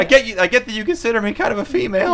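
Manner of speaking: high, mock-timid objection; meek caller. Bullied caller objecting to Chad's insults, meek and high. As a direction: protest feebly in a put-on high voice.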